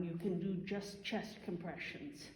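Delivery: ordinary delivery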